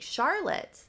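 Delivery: high-pitched call, rising at the end; calling after someone. Retold or imitated. Leif runs after Charlotte, calling out. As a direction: call out as if chasing her.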